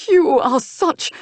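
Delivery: high, loud, slow sneering start. Acted. Duke sneers at uncle but finds no insult, sneering. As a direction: sneer it then lose the word. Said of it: "the Duke broke off his sneer".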